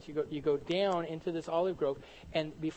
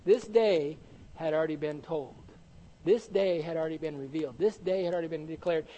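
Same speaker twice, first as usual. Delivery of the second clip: usual speaking voice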